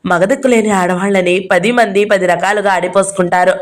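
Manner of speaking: ordinary delivery, nothing marked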